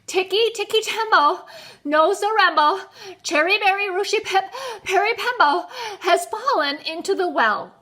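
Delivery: high, loud and out of breath; panting. A narrator's reading. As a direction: pant between bursts of the long name. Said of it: "he panted".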